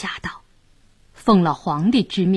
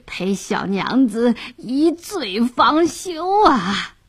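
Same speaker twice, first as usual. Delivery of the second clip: slow, boozy slur, words stretched out; drunken lecher; 3 semitones higher, slower than usual. A narrator's reading. Drunk official toasting the pretty fishwife, slurred and doting. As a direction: indulge the beauty with tipsy relish. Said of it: "直喝得烂醉如泥".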